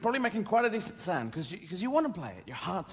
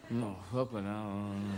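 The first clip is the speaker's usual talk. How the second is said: low, soft resignation; giving up; 11 semitones lower, 7 dB quieter than usual. Acted. Parent abandoning the music plea, giving up. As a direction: drop right down and let it go.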